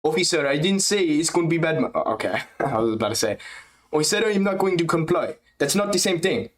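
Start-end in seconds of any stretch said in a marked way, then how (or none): 0.0-1.9 higher voice, a hint of a Slavic-sounding accent; bad DDP impression. Streamer attempting DDP impression, lightly accented voice. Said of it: "I have a terrible D D P impression". 3.9-6.5 higher voice, a mock Slavic accent; Slavic-sounding accent. Streamer's DDP attempt, accidentally Slavic accent. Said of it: "I sounded like a Slavic dude there".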